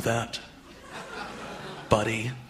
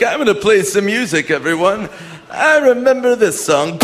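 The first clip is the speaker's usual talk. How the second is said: himself as a grandpa; 5 semitones higher than usual. Retold or imitated. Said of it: "I'm gonna be like".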